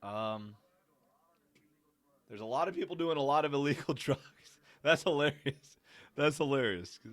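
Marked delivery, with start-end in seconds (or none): 2.3-7.0 higher and louder, laughing through it; amused. Real, not acted. Laughing at the documentary's drug comment, amused and loud. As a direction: crack up at the line.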